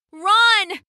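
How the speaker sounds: high, loud alarm; panicked cry. A narrator's reading. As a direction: cry out a warning to all. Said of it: "they cried".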